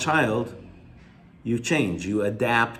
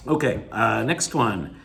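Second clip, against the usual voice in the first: usual speaking voice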